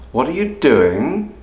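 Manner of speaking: doing dips then rises; fall rise tone. Shown as a demonstration. Teacher comparing three ways to ask. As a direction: scoop down and up on doing. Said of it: "are all fundamentally the same question".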